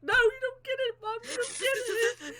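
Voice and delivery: high, loud, sobbing wail; a child wailing that mum doesn't understand; mock crying protest. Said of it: "And I was like".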